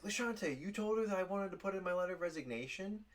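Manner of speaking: high, loud, rushed question; mock outraged accusation. Employee confronting coworker about resignation secret, high mock outrage. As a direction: squeal the accusation in hammed-up disbelief.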